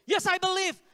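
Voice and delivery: high, loud "Yes, I believe"; shouted answer. Retold or imitated. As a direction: answer like a recruit at drill. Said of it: "I can't hear you".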